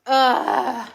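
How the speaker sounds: disgusted ugh. Real, not acted. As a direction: groan loudly, grossed out. Frustration before redoing it.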